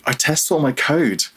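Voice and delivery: retold disbelieving self-defence; indignant protest. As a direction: protest your innocence, half amused. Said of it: "And I was like".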